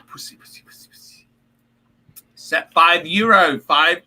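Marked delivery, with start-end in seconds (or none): 0.1-1.3 very soft, voiceless whisper. Pretend. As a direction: whisper it, fading almost to nothing. Mutters the word to himself.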